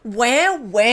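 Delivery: put-on high, loud whining baby voice; whiny baby voice. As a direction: whine it like a spoilt baby. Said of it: "little whiny baby voice".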